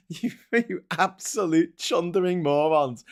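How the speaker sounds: slow, high-pitched exasperated insult; exasperated anger. Acted. Presenter berating politicians, comically angry. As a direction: spit out the insult slowly, half amused at your own anger. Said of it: "I get really angry".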